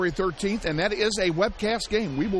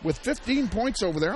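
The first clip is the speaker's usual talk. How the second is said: usual speaking voice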